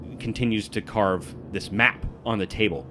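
ordinary talk

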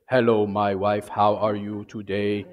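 mock robotic husband; robotic greeting. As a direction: say it like a stiff robot. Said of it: "if I come to my wife and I speak to her".

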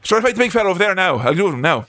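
quick mock Irish traveller patter; Mickey. Acting the boxer offering a fight, Irish traveller accent. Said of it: "But Mickey, Brad Pitt, uh, says".

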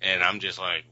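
ordinary talk

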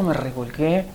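ordinary talk

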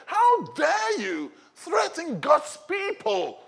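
high, slow, indignant shout; outraged champion defending his people; angry. Acted. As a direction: hurl it slowly at the enemy in righteous anger. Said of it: "He was angry in his spirit".

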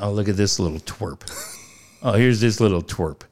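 a hint of Irish accent on a mocking jibe; Conor McGregor. Host imitating the coach taunting a rival, light Irish accent. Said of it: "Conor would say in that accent".

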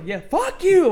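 high-pitched retold outburst; hammed-up shout at rude customers; mock angry yell. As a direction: shout it as an imagined comeback. Acting out almost cursing out customers.

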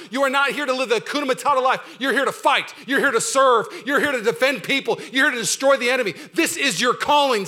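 ordinary talk, nothing marked

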